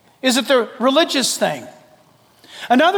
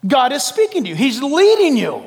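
ordinary talk

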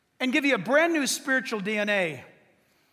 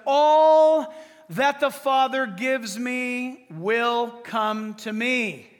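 solemn scripture; 3 dB louder, much slower than usual. Acted. Quoting Jesus solemnly.

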